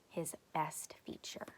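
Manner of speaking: soft unvoiced whisper. A narrator's reading. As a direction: whisper it like a secret. Narrator whispers aside.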